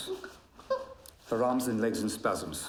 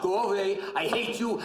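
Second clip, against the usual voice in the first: raised, higher-pitched call; demonstrated shout; 7 semitones higher than usual. Acted. Doctor coaching patient to shout, voice raised. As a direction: firmly model the shout. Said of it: "you shout".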